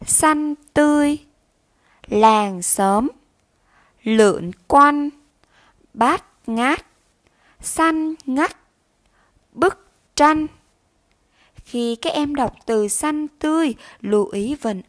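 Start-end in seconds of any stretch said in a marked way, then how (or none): 0.1-1.2 teacher models "Xanh tươi" word by word; careful pronunciation. Shown as a demonstration. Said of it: "chú ý phát âm và đọc đúng các từ sau". 2.1-10.5 slow, clear, louder word by word; careful pronunciation. Shown as a demonstration. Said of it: "chú ý phát âm và đọc đúng các từ sau".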